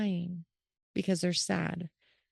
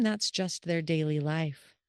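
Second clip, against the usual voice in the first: ordinary delivery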